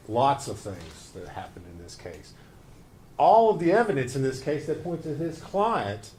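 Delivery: loud, forceful courtroom address; passionate closing argument. Acted. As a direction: drive it home to the jury. Prosecutor opens closing argument.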